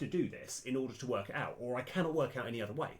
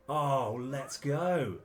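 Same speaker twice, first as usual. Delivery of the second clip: louder, higher burst of excitement; really excited; 5 semitones higher, 7 dB louder, much slower than usual. Real, not acted. Gamer about to explore unseen ground, excited cheer. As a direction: cheer yourself on, thrilled. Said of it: "So I'm actually really excited".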